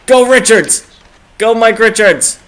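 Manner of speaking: loud, high cheer for the player; excited calling out; urging shout. Real, not acted. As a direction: yell him on up the ice.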